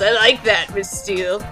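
normal talking voice

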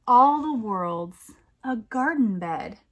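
slow, lilting picture-book reading; poem read aloud. A narrator's reading. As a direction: read it like a bedtime rhyme for kids. Poem refrain.

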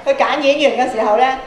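ordinary delivery

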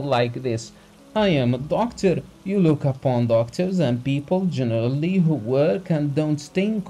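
high, loud lecturing anger; the doctor scolding a rich man, loud and high; heated rebuke. A narrator's reading. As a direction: lash him with contempt. Doctor continues his tirade.

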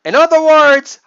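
high, loud put-on mom; mock mom voice. Voicing mom's hidden meaning.

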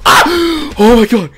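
high loud gasp then laugh; startled gasp. Real, not acted. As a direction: gasp in shock. Sudden scare.

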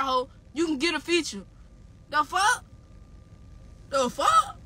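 ordinary talk

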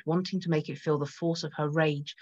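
plain reading voice

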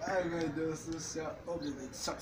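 breathless and shocked by cold water; shivering gasps in ice cold water; gasping from the cold. Real, not acted.

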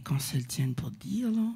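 a whispered joke to the crowd; whispered aside. Real, not acted. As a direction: slip it in as a secret whisper. Mock-serious aside to audience.

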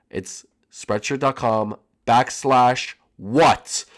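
ordinary talk

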